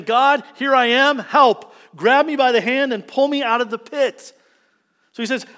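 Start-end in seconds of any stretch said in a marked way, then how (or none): 0.0-4.3 loud, high cry for help; pleading prayer. Acted. Israelite with hands lifted to heaven, loud plea. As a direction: beg God urgently at full voice. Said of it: "it's like a way of saying".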